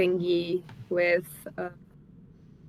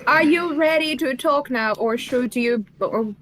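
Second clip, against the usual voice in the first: in-character shout; 2 semitones higher than usual. Acted. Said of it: "I'm going to shout at the man".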